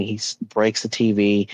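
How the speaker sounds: ordinary delivery, nothing marked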